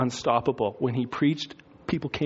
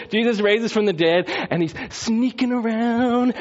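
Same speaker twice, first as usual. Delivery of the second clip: ordinary talk, nothing marked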